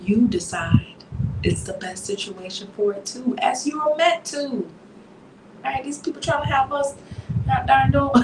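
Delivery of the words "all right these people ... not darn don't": put-on a higher, quick slip into a Southern accent. Coach teasing her listeners in a light Southern accent. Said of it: "Sometimes I get a little Southern too".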